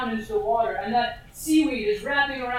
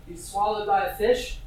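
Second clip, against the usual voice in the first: ordinary talk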